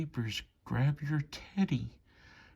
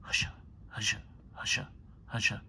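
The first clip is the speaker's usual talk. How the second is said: hushing whisper; 3 semitones lower, 4 dB quieter, much faster than usual. Acted. Lulling the train to sleep, whispered husha. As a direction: hush a sleepy child.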